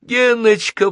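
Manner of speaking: put-on high, loud girl's cry for a friend; acted crying plea; tearful cry. A narrator's reading. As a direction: call out in tearful alarm, high-pitched. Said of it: "плакала Наташа".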